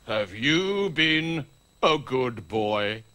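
low, very slow questioning; Father Christmas. Pretend. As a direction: ask weightily as a put-on Santa. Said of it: "I'm pretending to be Father Christmas".

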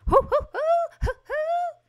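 very high, loud "hoo-hoo" whoops; excited falsetto whooping; gleeful hooting. Real, not acted. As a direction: hoot with delight in a high voice. Delighted whooping at the wall.

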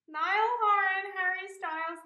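emotional fan reading names aloud; overwhelmed. Real, not acted. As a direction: read the names out as they hit you.

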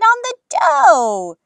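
loud, high, drawn-out amused correction; amused exclaiming. A narrator's reading. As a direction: stretch it out high and loud, playfully. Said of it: "Grandma laughed".

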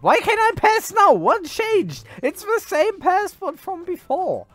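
indignant surprise. Real, not acted. Suddenly refused passage again.

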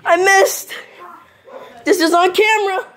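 loud, high, panicky shouting; panicked yell. Real, not acted. Missed shot, panics on camera.

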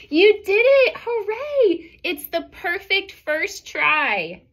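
high, loud joyful cheer with a laugh; excited cheer. Acted. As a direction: burst out cheering for her niece. Aunt cheers Rosie's flop.

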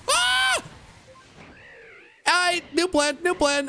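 high frantic scream, then rushed new plan; panicked yell. Real, not acted. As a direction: yelp in panic.

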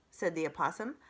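plain reading voice

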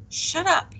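firm, high-pitched hushing command; quiet but firm. A narrator's reading. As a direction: cut them off firmly. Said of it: "quiet but firmly".

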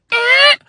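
high loud creak; squeaky stair. Acted. The stair giving Mike away, loud high squeak. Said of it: "The stair said".

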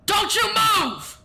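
high, slow shout at the bird; shouted warning. Real, not acted. Streamer yelling at the game bird, high slow shout. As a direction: yell it out, stretched and sharp.

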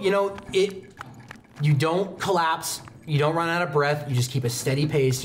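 ordinary talk, nothing marked

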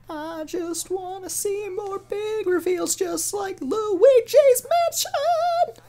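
high stretched plea bursting into a cry on Mansion; mock plea. Gamer begging the Direct for reveals, mock anguished.

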